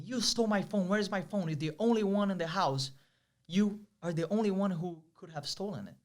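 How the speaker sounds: hammed-up outrage, pitch pushed up; angry accusation.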